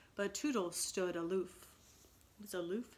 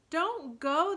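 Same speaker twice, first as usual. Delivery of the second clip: higher, louder, calling out in pity; 8 semitones higher, 8 dB louder than usual. A narrator's reading. Lost boys begging Toodles to stay, pitying call. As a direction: plead with him not to leave. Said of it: "they called in pity".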